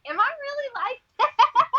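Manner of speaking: high, loud laughing question; giggling, high surprised question; laughing disbelief. Retold or imitated. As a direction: laugh while asking in disbelief. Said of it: "I'm like".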